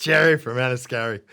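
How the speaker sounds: hammed-up bad Irish accent; a playful stab at an Irish lilt; mock Irish accent. Actor mocks his own film accent to interviewer, bad Irish accent. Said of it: "Worst, worst Irish accent ever".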